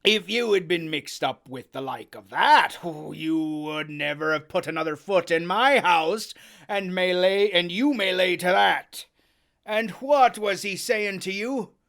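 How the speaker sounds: loud gruff sailor scolding; Silver scolding. Acted. Silver scolds Morgan, loud. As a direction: lay into him.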